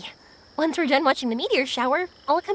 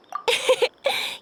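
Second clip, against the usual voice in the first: light airy giggle; 7 semitones higher than usual. Acted. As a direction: laugh it off shyly. Bashful laugh.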